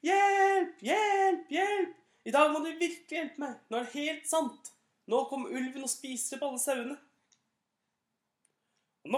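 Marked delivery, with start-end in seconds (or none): 0.1-7.0 high, loud pleading yell, easing off at the end; shouting for help. Acted. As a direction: beg them to believe it this time. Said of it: "og ropte". (høyt, bedende rop om hjelp)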